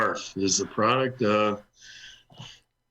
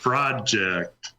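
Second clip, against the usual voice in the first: "project" with PROJ- leaned on; deliberate "project", weight on the first syllable; stress on first syllable. Shown as a demonstration. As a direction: say "project" as the noun, PROJ-ect. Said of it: "Take the first syllable".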